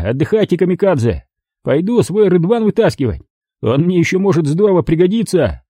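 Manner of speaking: high, scornful thug bark; irritated thug. Acted. Thug snaps at the intruder in a high scornful voice. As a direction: snap at the intruder.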